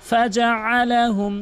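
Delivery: slow, melodic tajweed recitation; Quran recitation. Acted. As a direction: chant it slowly, holding the long vowels. (সুরেলা ধীর কোরআন তিলাওয়াত)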